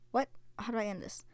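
usual speaking voice

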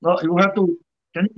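ordinary delivery

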